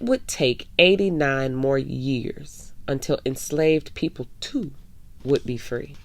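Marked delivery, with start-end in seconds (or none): none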